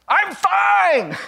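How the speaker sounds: sudden high, loud outburst; shouting. Retold or imitated. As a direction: blow up on the last one. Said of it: "And then she shouts".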